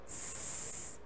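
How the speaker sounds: a long voiceless sss hiss; drawn-out sss; hissed S sound. Shown as a demonstration. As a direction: hiss out the S like a snake. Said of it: "representado pela letra S".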